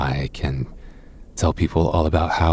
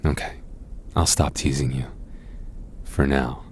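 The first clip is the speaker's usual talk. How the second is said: ordinary talk, nothing marked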